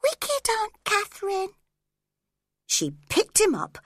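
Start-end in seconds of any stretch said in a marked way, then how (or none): 0.0-1.5 put-on sobbing child. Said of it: "started sobbing and saying".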